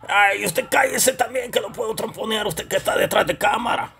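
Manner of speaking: high, touchy comic puppet voice; high-pitched character telling off the cameraman; quarrelsome puppet character. Acted. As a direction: squabble back in a high puppet voice. Puppet Deca squabbles with cameraman.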